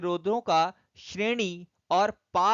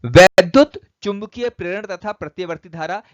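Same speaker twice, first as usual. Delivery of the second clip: louder announcement of the new unit; loud heading; 12 dB louder than usual. Real, not acted. Teacher announcing the next unit to students, loudly. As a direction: call out the unit title to the class.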